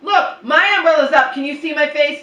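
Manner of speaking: loud, high, insistent no; high exasperated scolding. Retold or imitated. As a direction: scold mom, repeating no sharply. Arguing with mom.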